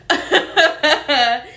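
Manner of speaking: high, loud burst of laughter; amused laugh. Real, not acted. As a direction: crack up at your own punchline. Laughs after "boom.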